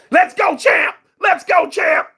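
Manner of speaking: high, loud hype shout; mock hype shouting; hyped kid. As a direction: pump yourself up like a young boxer. Voicing the kid's hype.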